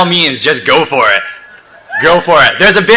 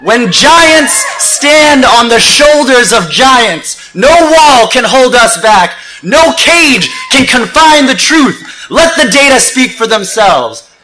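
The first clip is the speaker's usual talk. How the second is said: raised, ringing rally oratory easing at the end; rousing spoken-word; 6 semitones higher, slower than usual. Acted. Speaker rallying thousands to stand together, rousing oratory. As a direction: project to the crowd, then settle on the last lines.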